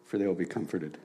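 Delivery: measured solemn scripture reading. Acted. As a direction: read it like holy text, pausing. Reads the Beatitudes aloud.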